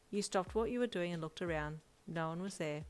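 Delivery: plain reading voice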